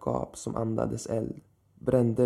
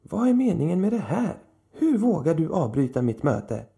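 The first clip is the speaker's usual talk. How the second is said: much higher and louder, outraged; indignant shout; 7 semitones higher, 5 dB louder than usual. A narrator's reading. Old man shouts at Lilith for interrupting, outraged. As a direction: shout at the intruder how dare you.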